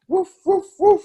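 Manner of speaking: high, light "woof woof"; playful dog bark. Pretend. Mom barking like a dog for her child, light and high. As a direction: bark like a friendly puppy.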